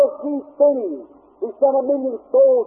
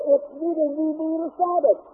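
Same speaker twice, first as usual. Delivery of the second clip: husband objecting; 4 semitones higher, 5 dB quieter than usual. Acted. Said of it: "her husband said".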